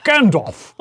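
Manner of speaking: hammed-up English accent, breaking out loud on the name; playful, grand English-accented proclamation; mock English accent. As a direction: put on a theatrical English voice. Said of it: "a slight English accent".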